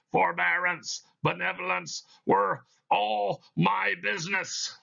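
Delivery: a chain-bound spirit intoning its doom; Marley's ghost. Acted. Reads Marley's ghost in the play.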